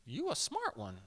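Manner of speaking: impressed and amused, half to himself; a soft, amused "Dang, you a smart one"; impressed Jesus. Retold or imitated. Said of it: "he was like".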